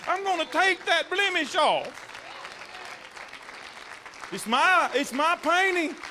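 loud, high, driving preacher climax; shouting the sermon home; preaching at full force. Acted. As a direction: lift the church with each line.

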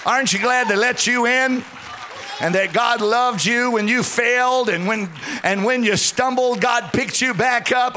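loud, high, driving preacher's climax; preaching at full force. Acted. Pastor rousing congregation to gratitude, shouted preaching. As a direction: lift the whole room with each aren't you glad.

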